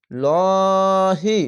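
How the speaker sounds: লহি with a thick dark ল, held very long; slow heavy chant; heavy lahi. Shown as a demonstration. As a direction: recite লহি full and heavy, very slowly. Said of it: "ওপরে থাকলে ল হবে".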